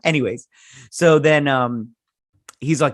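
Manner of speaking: usual speaking voice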